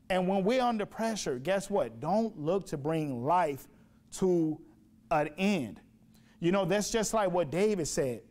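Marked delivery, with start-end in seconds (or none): 2.0-5.8 spaced out, word by word; slow and deliberate. Acted. Preacher warning the congregation, slow and deliberate. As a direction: let each word land.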